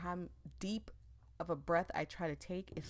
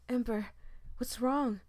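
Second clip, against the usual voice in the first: alarmed; 5 semitones higher, 6 dB louder than usual. Acted. Friend alarmed by Ember.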